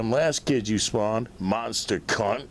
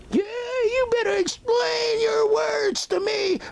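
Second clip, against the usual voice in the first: much higher, slow, wailing shouted mimicry; mock crying woman; slower than usual. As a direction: ham up her sobbing demand. Mocking her crying complaint.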